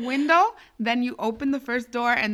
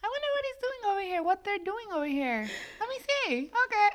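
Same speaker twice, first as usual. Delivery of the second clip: playing innocent detective, then busted; mock nosy singsong; 9 semitones higher, faster than usual. Retold inner monologue in car.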